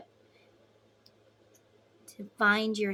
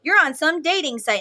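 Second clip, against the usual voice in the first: much higher and louder, a crying-out no that stays loud; retold angry refusal; 5 semitones higher, 9 dB louder than usual. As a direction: shut him down hard, indignant.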